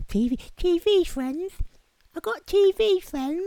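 very high squeaky echo; put-on falsetto, teasing yourself; silly mocking voice. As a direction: squeak it like a tiny incredulous heckler. Mocks own boast in silly voice.

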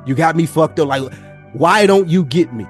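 normal talking voice

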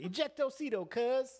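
high-pitched parting catchphrase; movie quote. Acted. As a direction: throw the film line out high and loud. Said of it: "I be saying that shit".

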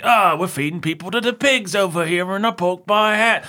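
high, hammed-up Cockney voice; mock Ritchie's crass gangster voice; high put-on Cockney thug. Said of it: "Ritchie's just like".

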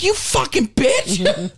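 high, slow, exaggerated outrage; mock indignant insult. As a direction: drag it out in joking fury. Retold retort to friend.

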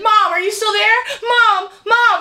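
high, loud panicked calls of Mom; a girl crying out for her mom; frantic high call to mom. Retold or imitated. Daughter calling out in panic.

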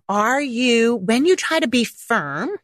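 normal talking voice

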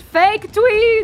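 high, loud shout; yelling. Real, not acted. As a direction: yell it out in disgust. Player yells insult.

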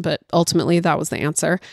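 ordinary talk, nothing marked

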